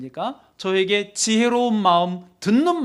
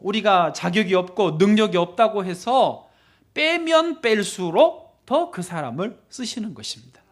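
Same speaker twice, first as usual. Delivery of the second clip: ordinary delivery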